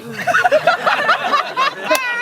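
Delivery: a squealing high cackle; high-pitched laugh. Real, not acted. Telling friends the mall story, a high squealing laugh. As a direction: laugh at your own story.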